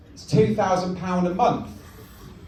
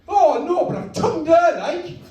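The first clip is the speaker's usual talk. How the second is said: high, horrified Geordie wail; Luke Skywalker; 8 semitones higher, 4 dB louder than usual. Acted. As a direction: lament it as an appalled Geordie son. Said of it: "I made him Geordie".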